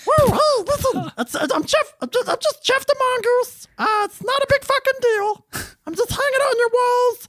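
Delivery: squeaky, very high falsetto creature; placating little critter in strained falsetto; talking mongoose voice. Acted. Mongoose excusing himself for living in the walls, squeaky falsetto. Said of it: "what would this have sounded like".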